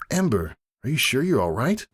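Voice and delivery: the startled cook asking if he's okay; worried coworker. Acted. Coworker checks on burned Ember.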